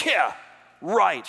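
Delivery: loud, high, scornful yeah right; Sarah's loud cynical retort; sarcastic scoff. Retold or imitated. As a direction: scoff at the promise in disbelief. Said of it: "she is scared, she is angry".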